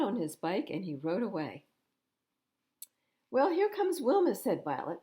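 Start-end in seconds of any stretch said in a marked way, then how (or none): 3.3-4.3 put-on high, louder little girl. A narrator's reading. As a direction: call it out brightly as a small girl. Violet voiced in read story.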